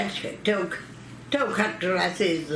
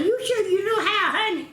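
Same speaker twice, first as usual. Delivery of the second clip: high, teasing question; teasing doubt; 11 semitones higher than usual. Retold or imitated. Mother teasing her sister-in-law about bathing the baby, high. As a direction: needle her playfully.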